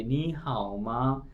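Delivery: नी हाओ मा said slowly, each syllable drawn out; textbook tones. Shown as a demonstration. Teacher models the textbook greeting for students, slow and careful. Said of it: "पढ़ाने के लिए बताया गया है".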